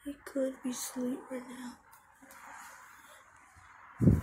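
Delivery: soft unvoiced whisper. Real, not acted. Kid whispering outside his sleeping friend's place. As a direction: hush so the friend can sleep.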